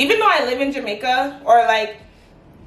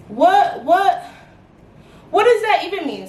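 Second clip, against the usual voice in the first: indignant disbelief; 6 semitones higher than usual. Real, not acted. Indignant at the remark.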